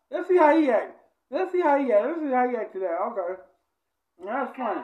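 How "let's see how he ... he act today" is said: loud, high, amused anticipation; excited. Real, not acted. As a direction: call it out gleefully. Excited reaction to the boss.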